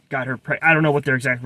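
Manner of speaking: plain reading voice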